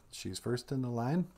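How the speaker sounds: usual speaking voice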